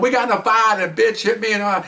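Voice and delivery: high-pitched worked-up husband retelling the fight; agitated explanation. Retold or imitated. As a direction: imitate an agitated man explaining himself.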